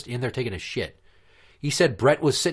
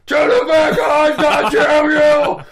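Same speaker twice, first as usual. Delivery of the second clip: long, high, full-volume Sloth-like holler; Brett the janitor; 11 dB louder, much slower than usual. Retold or imitated. Janitor heard all the way down the hall, slow mock-furious screaming. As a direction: drag out each word in a hammed-up bellow. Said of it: "I just hear the".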